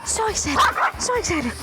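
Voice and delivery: real delight at the arriving dogs; excited dog greeting. Real, not acted. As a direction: squeal with joy at the doggies.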